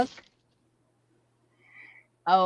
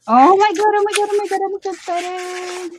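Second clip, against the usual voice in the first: excited squeal; 9 semitones higher, 3 dB louder than usual. Real, not acted. Unboxing the last surprise.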